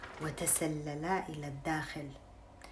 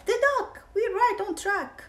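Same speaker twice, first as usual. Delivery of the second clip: much higher, louder thief; squeaky pleased crook; 7 dB louder than usual. Acted. Thief Hugh cheering his partner on, high and loud. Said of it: "Hugh said".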